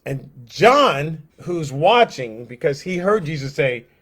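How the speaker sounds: ordinary talk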